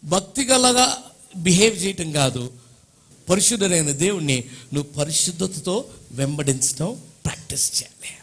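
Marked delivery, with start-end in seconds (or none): 6.2-8.1 soft unvoiced sermon aside; whisper. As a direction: drop to a whisper. Whispered over music.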